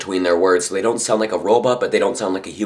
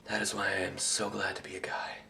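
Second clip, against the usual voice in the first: unvoiced whisper; 14 dB quieter than usual. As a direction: whisper it confidingly. Sudden whispered lines.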